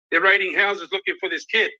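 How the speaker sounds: normal talking voice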